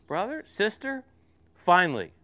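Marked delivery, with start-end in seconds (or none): 0.1-1.0 put-on higher, small child calling in the dark; a child checking that mommy is near; little girl's voice. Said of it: "this little voice".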